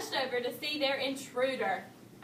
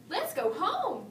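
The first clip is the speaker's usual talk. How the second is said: calling out; 6 semitones higher than usual. A narrator's reading. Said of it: "cried Papa Bear".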